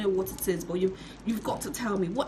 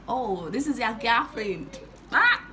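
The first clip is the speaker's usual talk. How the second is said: gushing trader. Retold or imitated. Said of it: "the trader, was like".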